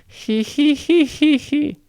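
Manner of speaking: very high nasal-free titter, sneering; high mocking giggle "he he he". A narrator's reading. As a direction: cackle up high at the brats. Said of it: "Nero said".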